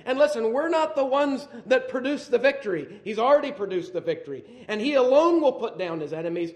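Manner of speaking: loud, high preaching voice building to a question; fiery preaching. Acted. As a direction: preach it hard to the congregation. Preaching at full force.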